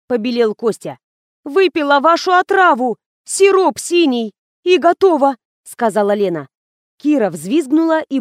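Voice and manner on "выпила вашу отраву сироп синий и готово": agitated. A narrator's reading. Lena agitated over apparent death.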